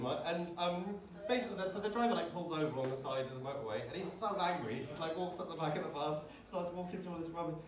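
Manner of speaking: ordinary talk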